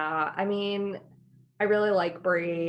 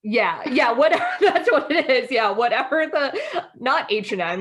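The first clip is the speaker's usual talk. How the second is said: louder, laughing through the words; 5 semitones higher, 5 dB louder than usual. Real, not acted. To her co-host about fast fashion, laughing loudly. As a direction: giggle while you talk.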